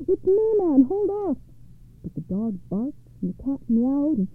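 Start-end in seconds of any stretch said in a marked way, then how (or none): 0.1-1.4 high-pitched cry of protest. Acted. Giant's wife in the sack to her husband, crying out. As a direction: cry out high to stop the blows. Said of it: "His wife cried".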